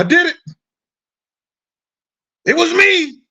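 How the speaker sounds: high, fast, squeaky mock boast; mock proud confession. Mocking defendants bragging to prosecutors, high squeaky boast. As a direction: chirp it like a proud fool. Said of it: "the motherfuckers are pleased".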